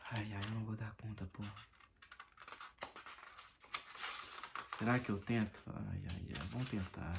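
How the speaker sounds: drowsy, low and soft; barely awake, fading; sleepy. Real, not acted. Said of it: "eu tô morrendo de sono já".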